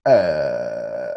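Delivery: low, slow captain announcement; pilot voice. Pretend. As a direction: talk like the captain over the intercom. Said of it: "That's, that's some good pilot voice there".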